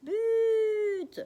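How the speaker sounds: high, loud, held cry of goal; goal shout. Acted. Mother cheering a goal on the TV, long loud shout. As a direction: scream it like a football fan. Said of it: "Mais ma mère crie".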